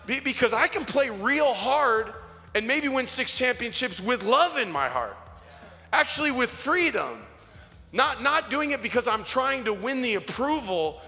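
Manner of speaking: high-pitched, driven pulpit exhortation; impassioned preacher pressing the point home; forceful preaching. Real, not acted. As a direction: challenge the congregation, punching key words. Preaches hard against dirty energy.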